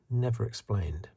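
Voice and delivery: ordinary talk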